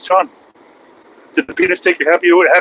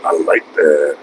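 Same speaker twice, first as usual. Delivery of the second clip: deep gloating; 5 dB quieter, slower than usual. Acted.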